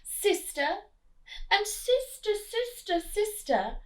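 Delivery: rising sing-song calls of sister; repeated call. Acted. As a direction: call again and again into the dark. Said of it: "the duck began to quack".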